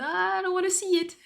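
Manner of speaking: high, loud mock plea; hammed-up high-pitched begging; exaggerated pleading. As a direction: plead dramatically to be spared, easing off at the end. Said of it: "du coup, je suis en mode".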